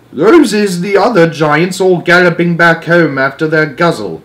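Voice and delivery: lightly voiced friendly giant; a hint of the giant's rustic speech. A narrator's reading. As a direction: giant pointing out the others. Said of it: "the B F G said".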